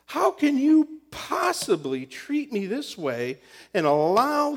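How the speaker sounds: loud, high, exasperated shouting at God; angry rant. Retold or imitated. Pastor reenacting his furious complaint to God. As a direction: vent fury at God as if wronged.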